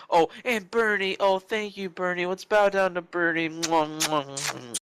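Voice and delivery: high, gushing mock worship; mock fawning. Co-host mocking devotion to Bernie, high fawning.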